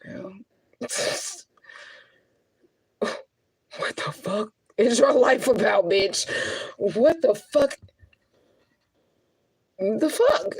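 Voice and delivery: high, slow, incredulous scolding of herself; mock-outraged at her own life, stretching each word; exasperated self-talk. Scolding herself in disbelief about her life, high and drawn out. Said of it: "I be like".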